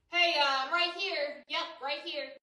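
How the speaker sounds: soft, offhand killer reply; casual killer. Pretend. Killer casually answering the victim's call, soft and offhand. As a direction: answer as if nothing's wrong. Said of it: "the killer's just gonna be like".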